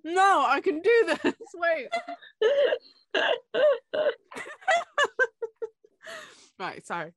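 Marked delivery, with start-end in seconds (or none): 0.0-1.9 high, loud giggling with mouth full; laughing. Real, not acted. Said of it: "trying to eat the chocolate". 3.1-5.7 very high, loud helpless giggling; high giggle fit. Real, not acted. Giggle fit while eating.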